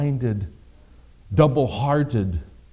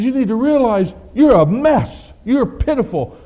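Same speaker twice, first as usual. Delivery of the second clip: preaching at full force; 11 semitones higher, 7 dB louder than usual. Acted. Preacher reading Jesus' rebuke to the church, full force. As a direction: hammer each word home like a judgment.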